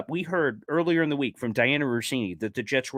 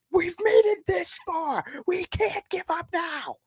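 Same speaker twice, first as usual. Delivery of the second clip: rallying cry; slower than usual. Acted. Rallying fans.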